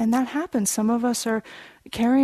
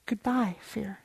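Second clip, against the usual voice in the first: very soft, falling away; quiet; 9 dB quieter, slower than usual. Retold or imitated. Son alone in the dark bids fear farewell, quiet. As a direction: let it drop gently to almost nothing.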